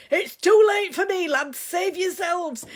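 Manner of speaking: loud, high hammed-up cry of doom, slowing at the end; mock shout. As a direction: bellow it like a sinking sailor, playing it up. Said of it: "he shouted".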